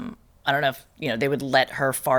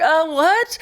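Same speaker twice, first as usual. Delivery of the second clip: high-pitched mock bewilderment; retold bewilderment; 12 semitones higher, 3 dB louder than usual. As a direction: squeak it as if thrown. Stunned reply to gushing nurse.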